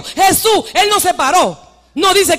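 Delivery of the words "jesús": high, short call to Jesus; crying out. Acted. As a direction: shout out as the blind man. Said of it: "empezó a gritar de su sitio".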